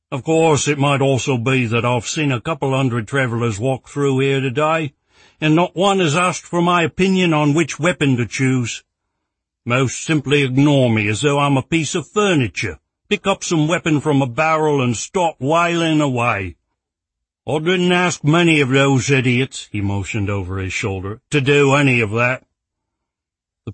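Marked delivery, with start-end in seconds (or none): 0.1-19.7 loud, higher exasperated rant; ranting shopkeeper. Acted. Rex ranting about travelers. 21.3-22.5 loud, higher exasperated rant; ranting shopkeeper. Acted. Rex continues his rant.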